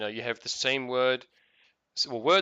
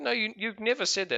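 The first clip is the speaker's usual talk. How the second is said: higher-pitched retold objection; incredulous protest; 9 semitones higher than usual. Retold quarrel with his wife.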